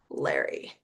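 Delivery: hushed, stunned whisper. Retold or imitated. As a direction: whisper his name in disbelief. Disbelieving reply to Larry.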